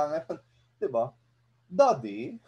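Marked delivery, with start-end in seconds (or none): 1.7-2.4 put-on child voice. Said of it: "para Cocomelon".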